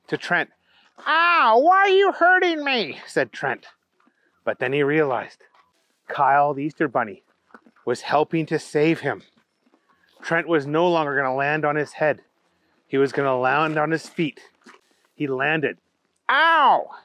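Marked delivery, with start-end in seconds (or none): 1.1-3.0 very high bunny wail; pained protest. Acted. Kicked in midair, complains. 16.3-16.9 short, high yelp; shouted in pain. A narrator's reading. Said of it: "he shouted".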